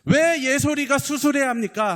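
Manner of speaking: high, loud plea to God; anguished protest, reenacted at full voice; crying out in protest. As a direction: shout the question up at God. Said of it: "이렇게 소리쳤을 때".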